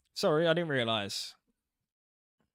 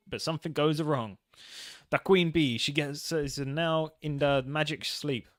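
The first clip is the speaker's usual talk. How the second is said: put-on Italian accent; 2 semitones higher than usual. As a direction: spin the legend like a mafia don. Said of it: "you gotta do an Italian accent".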